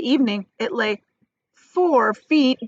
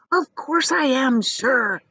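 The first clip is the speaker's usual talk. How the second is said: higher, slow, excited insistence; excited sputter; 6 semitones higher, slower than usual. Acted. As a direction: sputter it out eagerly. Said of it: "sputtered the old man excitedly".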